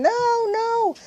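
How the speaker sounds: a hint of the raving patient wailing no; frantic no. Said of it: "screaming, crying".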